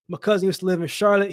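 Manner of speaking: normal talking voice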